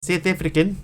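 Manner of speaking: put-on South African, set against Australian; South African accent. Shown as a demonstration. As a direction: show off South African vowels. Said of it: "That's how you can tell the difference".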